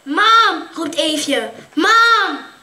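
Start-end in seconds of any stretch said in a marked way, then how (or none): 0.0-0.7 loud high call across the room. Acted. Girl at the window calling her mother, loud call. 1.7-2.5 loud high call across the room. Acted. Girl at the window calling her mother, loud call.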